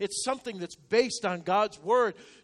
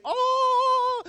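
a lightly sung, high mock angelic "Oh"; mock angel chorus; 7 dB louder than usual. Preacher mocks angels announcing forgiveness, lightly sung and high. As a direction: tease it like a heavenly choir swooping in. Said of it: "the chorus of angels say".

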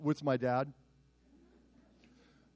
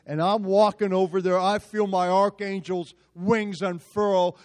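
ordinary delivery

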